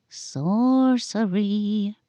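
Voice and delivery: slow, drawn-out ghostly whisper; spooky voice. Acted. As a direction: stretch it like a haunted house host. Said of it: "Amelia said in a spooky voice".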